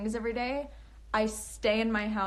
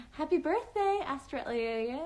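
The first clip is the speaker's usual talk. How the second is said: birthday greeting; 4 semitones higher than usual. Real, not acted.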